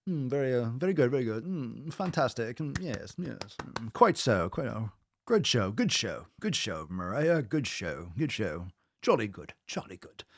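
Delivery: lightly hammed-up posh British gent; plummy British approval, tossed off as a joke; mock posh British. Praising Mariah's gains in a mock posh British accent. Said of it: "then we say".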